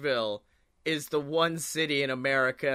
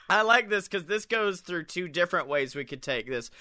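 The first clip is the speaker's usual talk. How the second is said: ordinary delivery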